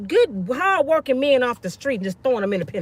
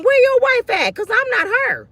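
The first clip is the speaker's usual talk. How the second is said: checking him; 10 semitones higher than usual. Retold or imitated. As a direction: confront him hard. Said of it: "I had to check him".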